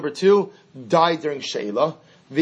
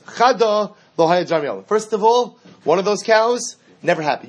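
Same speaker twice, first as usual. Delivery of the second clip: raised, louder protest that it never happened; loud borrower denial; 6 semitones higher, 5 dB louder than usual. Acted. Borrower loudly protesting to the owner. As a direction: push back hard and high.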